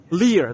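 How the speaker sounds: "Lear" said as LEE-er; long ee in "Lear"; corrected pronunciation. Shown as a demonstration. Vlogger settling how the logo reads, "Lear" as LEE-er. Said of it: "the pronunciation is Lear".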